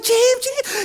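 very high-pitched calling out; Mark Anderson. Retold or imitated. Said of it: "and he's like".

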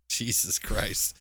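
ordinary delivery

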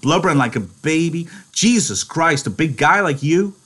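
high, loud scornful jab. Acted. George sneering at Lenny's blubbering, scornful. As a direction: sneer at the crying.

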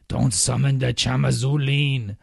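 loud, slow, hammed-up dread; mock demon warning. Host jokes about summoning a demon, hammed-up warning. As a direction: play a dramatic warning against summoning a demon. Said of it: "sounds like some African demon".

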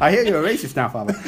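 put-on playful Irish accent; a hammed-up Irish brogue. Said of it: "Just keep doing my great Irish accent".

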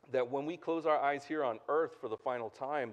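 ordinary talk

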